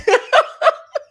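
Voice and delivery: loud, high cackle; big laugh. Real, not acted. Streamer reacting to Lindy cosplaying comment, laughing hard. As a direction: burst out in real, high laughter.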